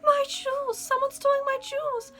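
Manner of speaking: very high, loud shrieking lady; screaming Duchess. A narrator's reading.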